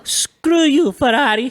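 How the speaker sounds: Ferrari with rolled R, shouted high; loud high Italian outburst on Ferrari; Italian accent. Shown as a demonstration. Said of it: "you guys are better at the voices".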